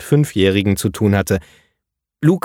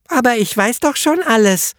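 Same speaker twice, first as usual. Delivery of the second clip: put-on high child voice, indignant; protesting little girl; 14 semitones higher than usual. A narrator's reading. Little girl protesting to the teacher, high and indignant. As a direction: whine it like a know-it-all girl. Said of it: "protestierte das kleine Mädchen".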